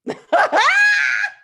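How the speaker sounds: loud, high burst of laughter; high shouted laugh. Real, not acted. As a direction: burst out laughing through tears. Host laughing through tears.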